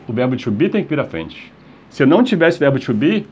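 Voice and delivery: usual speaking voice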